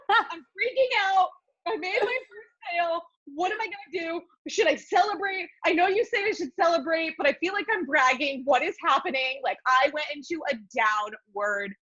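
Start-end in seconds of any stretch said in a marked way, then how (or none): 0.0-9.4 frantic freak-out. Retold or imitated. Messaging friend Jen after first sale, frantic excited panic. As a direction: spill the panic out, half laughing. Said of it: "I messaged Jen and I'm like".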